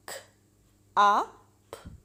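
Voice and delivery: ख, आ, प as separate "k", short "u", "p"; sounded out. Shown as a demonstration. Teacher spelling out cup by sound, ख, आ, प each isolated. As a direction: break cup into ख, आ, प with long gaps. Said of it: "short sound में example".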